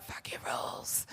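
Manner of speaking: whispered, under the breath; a whispered act of defiance; defiant whisper. Retold or imitated. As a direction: hiss it like a secret rebellion. Defiant aside, hushed.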